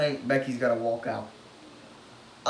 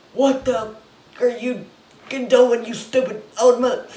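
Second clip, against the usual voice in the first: much higher, louder furious rant at the TV; retold angry yell; 10 semitones higher, 9 dB louder than usual. Fan screaming at Vince on the TV, retold furious yell. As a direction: blow up at the screen in mock rage. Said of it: "I was screaming at my T V".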